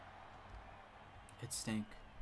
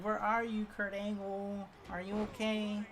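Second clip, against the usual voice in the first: put-on older southern woman; 6 semitones higher than usual. Older teacher checks on Kurt Angle, concerned southern accent. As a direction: fuss over him in a put-on southern accent. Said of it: "That's all she kept saying".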